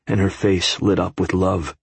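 plain reading voice

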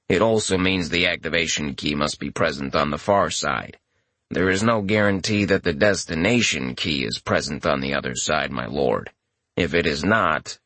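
low, level and emotionless; deadpan familiar voice. A narrator's reading. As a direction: speak without any feeling, deep and even. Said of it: "Futon's deadpan voice".

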